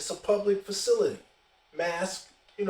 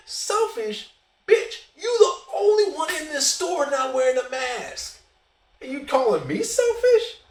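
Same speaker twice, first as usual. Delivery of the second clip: higher, louder indignant retort; outraged comeback; 7 semitones higher, 4 dB louder than usual. Retold or imitated. The storyteller snapping at the Frenchman who called him selfish, outraged. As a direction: fire it back in disbelief.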